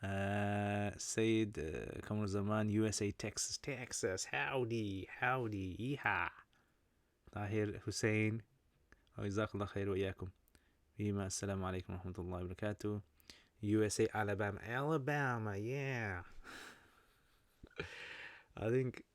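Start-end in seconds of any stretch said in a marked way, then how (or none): 3.6-4.3 mock Texan accent on Texas. Shown as a demonstration. Repeats state name in mock accent. 4.3-6.3 playful mock Texan accent; Texas accent. Puts on Texan greeting after Texas. 14.7-16.2 mock Alabama accent with stretched vowels. Shown as a demonstration. Repeats state name in mock accent.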